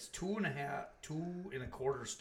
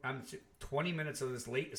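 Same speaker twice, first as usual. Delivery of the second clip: usual speaking voice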